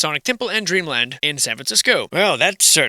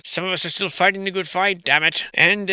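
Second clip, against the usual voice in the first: ordinary talk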